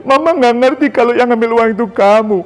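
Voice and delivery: high, loud, wailing plea; mother's tearful apology. Retold or imitated. Mother weeping her own apology to her son. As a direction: cry out for forgiveness.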